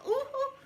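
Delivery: very high-pitched "oh, oh"; startled squeal. Retold or imitated. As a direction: yelp as if suddenly squeezed. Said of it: "I even made this noise".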